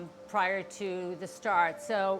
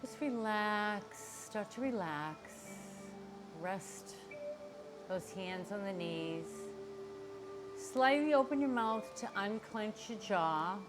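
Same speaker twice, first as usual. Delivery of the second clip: guided meditation; 6 dB quieter than usual. Acted. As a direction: ease them into calm, quietly. Guides relaxation before breathing.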